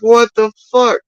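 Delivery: retold outrage. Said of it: "I'm like".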